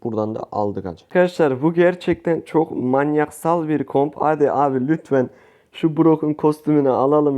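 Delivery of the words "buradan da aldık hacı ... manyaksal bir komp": ordinary delivery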